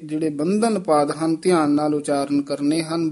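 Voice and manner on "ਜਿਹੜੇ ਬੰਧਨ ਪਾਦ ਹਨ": usual speaking voice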